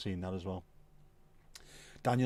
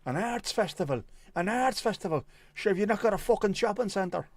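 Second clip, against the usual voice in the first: much higher and louder, incredulous Irish rant; high, loud Irish disbelief at an arts festival; Irish comedian impression; 9 semitones higher, 4 dB louder than usual. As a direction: mimic Tommy Tiernan's outraged Irish voice. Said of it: "when he's talking about Navan".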